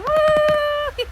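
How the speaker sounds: high, loud cheer; excited whoop. Real, not acted. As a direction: whoop with delight. Cheer after song.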